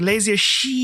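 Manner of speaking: "shit" stretched out long, rising; elongated word. Retold or imitated. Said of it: "you elongate other words".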